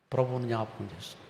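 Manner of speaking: normal talking voice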